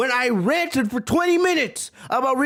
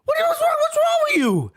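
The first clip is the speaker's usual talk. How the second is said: shocked outburst. Real, not acted. Host reacting to a swearing chat with a shrieking outburst. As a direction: explode at the chat in disbelief.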